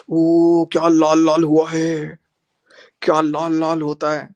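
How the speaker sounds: loud, drawn-out mock wonder; mocking exclamation. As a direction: exclaim it slowly, stretching the words in mockery. Mockingly exclaims over a slap mark. (ताना मारते हुए खींचकर बोलना)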